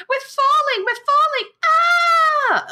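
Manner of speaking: very high, shrieking we're falling; mock child scream. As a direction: scream like a little girl on a ride. Little girl screaming on descent.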